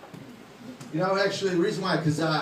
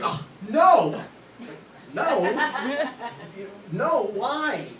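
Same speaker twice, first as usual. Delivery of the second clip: wife's shocked protest; 6 semitones higher, much slower than usual. Retold or imitated. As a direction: play the wife horrified at his offer, crying out no. Wife voiced in retold story.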